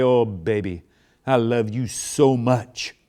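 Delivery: slow, gushing I love you; maximum passion. Shown as a demonstration. Husband to wife before leaving, gushing love. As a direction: lay it on with passion. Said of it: "Grab 'em by the shoulders and say, maximum".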